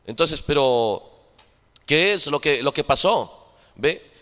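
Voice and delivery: ordinary talk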